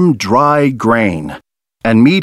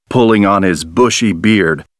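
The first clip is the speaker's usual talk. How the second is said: ordinary delivery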